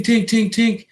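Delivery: high clipped tink noises for punches; punch sound effects. Retold or imitated. Describing the fight to viewers, punch sound effects. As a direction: mimic fists landing, light and high.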